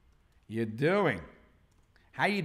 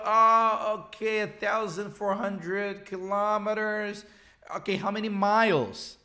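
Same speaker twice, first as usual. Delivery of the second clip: higher, held-out monotone of polite confusion; puzzled American listener; 4 semitones higher than usual. Acted. American hearing a distance in kilometers, mock puzzled. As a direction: play someone lost in the numbers. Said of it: "So the guy is gonna go".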